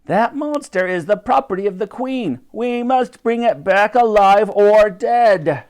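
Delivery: high, forceful commanding voice; villain's snarled proclamation; Barker. A narrator's reading. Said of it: "snarled Barker".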